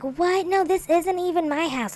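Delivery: high-pitched, protesting disbelief; mock indignant denial. Vlogger mimics housemate denying it's his house, mock indignant. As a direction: imitate someone protesting their innocence.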